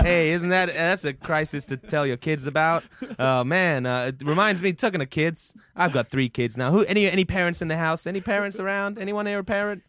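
ordinary talk

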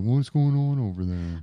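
lightly voiced usher calling someone out; a hint of an usher checking up; suspicious usher. Usher spotting someone with a flask, lightly acted suspicion. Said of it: "the usher's gonna be like".

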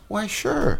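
high, drawn-out mock-casual yes; eager agreeable reply. Stand-in chef accepting a trip offer, eager agreeable reply. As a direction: imitate yourself agreeing in a high, soft lilt.

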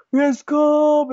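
loud, high celebratory shout; imitated fans' cheer. Guys from the pub greeting Joe, rowdy mock cheer.

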